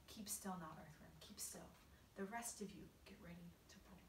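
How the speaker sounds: hushed, secretive orders; whispering. A narrator's reading. James readying the earthworm and crew, whispering. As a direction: keep it very soft, nearly all breath. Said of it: "whispered James".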